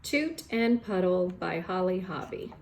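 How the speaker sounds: ordinary delivery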